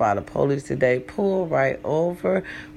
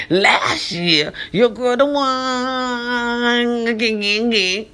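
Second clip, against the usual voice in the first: dramatic mock wail; 6 semitones higher, slower than usual. Mock wail about getting sick.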